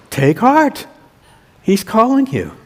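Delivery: encouraging. Acted. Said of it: "They said".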